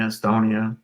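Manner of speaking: normal talking voice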